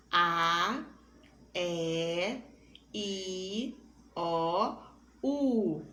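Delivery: A, E, I, O, U each held and spaced for a call-and-repeat; vowels for the class to repeat. Shown as a demonstration. Teacher calls out vowels for the whole class to repeat. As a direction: lead the class through the vowels, one at a time. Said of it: "todo mundo repetindo junto".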